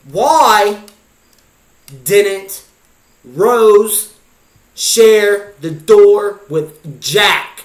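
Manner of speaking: loud, high, word-by-word yelling; outraged shout. Acted. Shouted outrage over a film ending.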